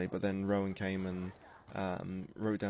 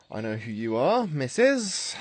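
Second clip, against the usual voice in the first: character voice; 4 semitones higher, 7 dB louder than usual. Acted. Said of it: "She loves that voice".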